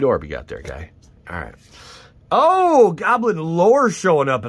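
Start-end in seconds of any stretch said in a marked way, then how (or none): 2.3-4.5 a high, loud burst of joy, then slower; excited shout. Real, not acted. Pulling a great card on camera, shouting excitedly. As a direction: yell out the lucky pull, thrilled. Big hit in pack.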